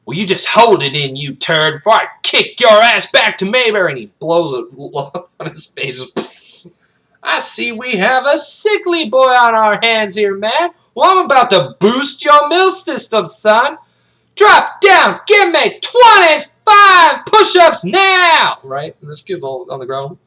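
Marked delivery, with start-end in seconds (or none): 0.1-3.9 loud drill-sergeant warning; raised pitch, building to a shout; threatening bark. Acted. 7.2-18.6 high, loud mockery building to a scream; full-volume military command, stretched at the end; drill-sergeant tirade. Sergeant orders punishment push-ups.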